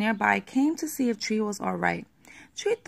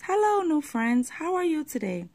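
bright, high, eager hello; excited greeting; 7 semitones higher than usual. Acted. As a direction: greet new friends eagerly. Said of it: "Tree got excited and waved".

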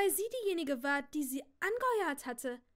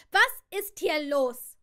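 alarmed demand; 3 semitones higher, 13 dB louder, much slower than usual. A narrator's reading. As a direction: demand it slowly and loudly. Sophie demands answers.

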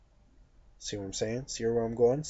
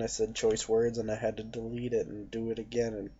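ordinary talk, nothing marked

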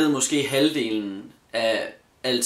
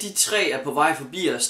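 ordinary talk, nothing marked

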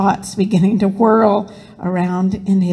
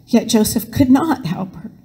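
ordinary delivery, nothing marked

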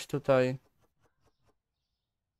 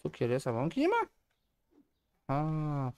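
ordinary delivery

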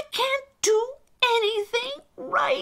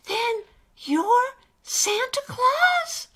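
very high, slow question; awestruck little character; 4 dB louder, slower than usual. Acted. Alistair realises his friend is Santa, awestruck. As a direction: squeak it out in astonishment.

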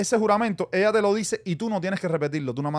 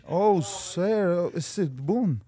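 mock nervous broken English; much slower than usual. Imitating himself at interview.

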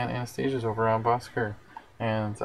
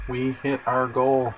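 excited announcement; 4 semitones higher, 5 dB louder, slower than usual. Real, not acted. Announcing the fundraising goal was reached.

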